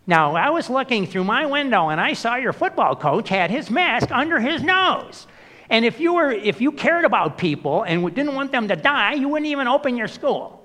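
high-pitched, loud busybody scold; complaining neighbor. Retold or imitated. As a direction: squeal it like a nosy neighbor phoning in a complaint. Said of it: "phone calls into school going".

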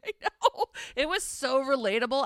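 laughing through the words. Real, not acted. Host agrees with co-host about stressful flub, laughing. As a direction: giggle while agreeing.

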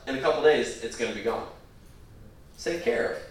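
usual speaking voice

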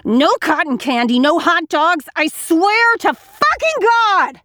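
building from a hiss to a high, loud slow yell; mother losing it in a furious rant; rising rage. Acted. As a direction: explode with each line. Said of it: "Suddenly, I'm full of rage".

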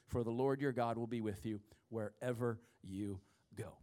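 a whisper to close the scripture; whispered promise. Acted. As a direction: breathe the promise softly to them. Ends reading on a hush.

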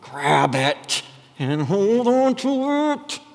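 slow, strained, drawn-out clutching voice; grasping Saul. Acted. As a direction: stretch the words as if clinging on tightly. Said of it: "That's a Saul word".

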